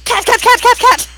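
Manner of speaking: high, loud frantic yapping; excited dog. Acted. The dog spots the cat and yelps excitedly. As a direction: blurt it out like a dog spotting a cat. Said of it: "And the dog's like".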